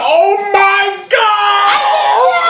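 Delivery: very high, long, loud imitated scream; pissed-off scream. Imitating a woman pissed at a prank, screaming. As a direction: shriek it out, drawing the last word way out. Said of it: "She was pissed. She like".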